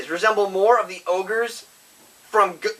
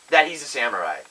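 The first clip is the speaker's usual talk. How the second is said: usual speaking voice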